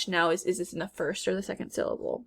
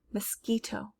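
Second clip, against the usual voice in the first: normal talking voice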